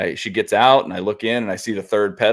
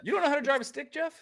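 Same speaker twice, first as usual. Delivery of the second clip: much higher, incredulous question; shocked; 4 dB quieter, faster than usual. Real, not acted. To his friend who can't drive stick, high shocked disbelief. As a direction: squeal it in disbelief.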